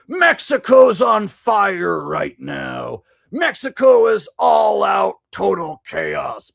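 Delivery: mocking panicked headlines, slowing on Mexico's on fire; mock sensational headline. Streamer mocking news headlines in a dramatic alarmist voice. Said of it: "a lot of the headlines are like".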